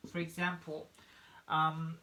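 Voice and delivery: normal talking voice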